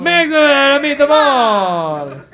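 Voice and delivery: high, slow, drawn-out crowd call; hype-man shout. Pretend. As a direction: bellow it like a rowdy emcee. Hypes crowd, echoing co-host's joke.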